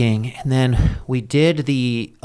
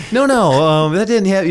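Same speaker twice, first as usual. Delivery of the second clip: laughing; 8 semitones higher, 5 dB louder than usual. Retold or imitated. Laughing while retelling a denial.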